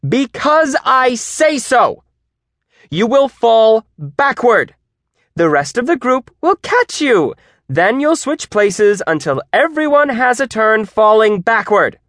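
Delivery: high, loud, emphatic orders; exasperated. A narrator's reading. Teacher who has had enough of questions, shouting exasperated orders. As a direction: lay down the law, stretching the key words. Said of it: "Miss Owens had enough".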